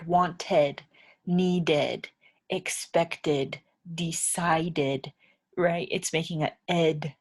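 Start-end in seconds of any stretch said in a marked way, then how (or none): 0.0-5.1 "Wanted, needed, expected, decided" with a full extra syllable on the ending; ed sound endings. Shown as a demonstration. Teacher to English learners, modelling the ed ending. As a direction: give each ending its own syllable. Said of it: "it makes an ed sound".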